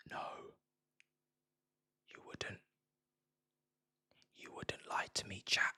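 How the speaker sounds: soft playful whisper; teasing ASMR whisper; mock ASMR whisper. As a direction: whisper it close to the mic as a joke. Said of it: "This is A S M R".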